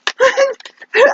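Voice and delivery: a short high burst of laughter; real laugh. Real, not acted. Laughing at herself for going out in work clothes, a short high laugh. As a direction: laugh at yourself, caught off guard. Laughing at own ridiculous outfit.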